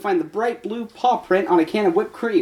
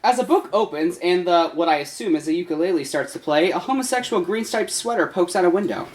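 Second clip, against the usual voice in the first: ordinary delivery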